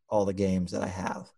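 usual speaking voice

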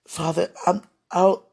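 normal talking voice

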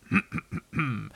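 a short run of little hums; throat-clearing noise. A narrator's reading. Pooh gets ready for his noise, clearing his throat. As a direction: clear the throat before performing. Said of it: "this was the noise".

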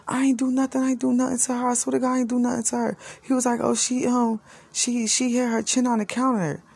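high, fast, nervous denial; pleading denial. Retold or imitated. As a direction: swear it's not your fault. Shaheem denying, voiced high.

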